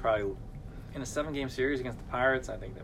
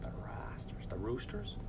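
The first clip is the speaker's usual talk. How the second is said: whispered repeat; 9 dB quieter than usual. Pretend. Muttering the word to himself.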